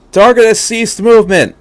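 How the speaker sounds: high, loud clipped military report; barked report. Acted. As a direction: bark it out like an officer. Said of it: "Lieutenant Hyuga barked".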